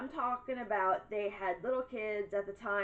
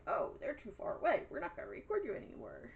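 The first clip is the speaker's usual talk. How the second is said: higher, quick, softer silly voice; mock camera voice; 4 semitones higher, 4 dB quieter, faster than usual. Mocking the neighbor's car camera, quick silly high voice. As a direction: voice the camera as a dopey character. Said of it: "your camera's like".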